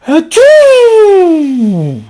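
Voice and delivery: a loud, high, explosive achoo; big sneeze. Acted. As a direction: blast out a huge cartoon sneeze. Gerald sneezes again.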